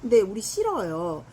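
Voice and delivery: ordinary talk, nothing marked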